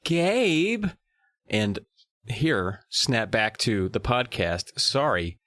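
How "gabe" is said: high sharp call of his name. Real, not acted. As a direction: call out to get his attention. Calling out to co-host.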